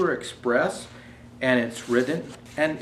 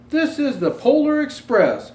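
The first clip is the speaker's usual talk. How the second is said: higher, proud announcement; conductor's announcement; 12 semitones higher, 7 dB louder than usual. Acted. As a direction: announce the train's name grandly. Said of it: "was his answer".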